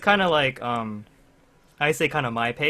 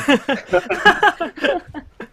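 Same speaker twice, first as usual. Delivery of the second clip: long high laugh; 7 dB louder than usual. Real, not acted. Laughing at the story.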